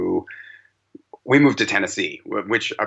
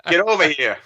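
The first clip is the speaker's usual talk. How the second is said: mock scolding; 7 semitones higher than usual. Parent telling daughter off about her accent, mock scolding. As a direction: scold in pretend outrage, half laughing.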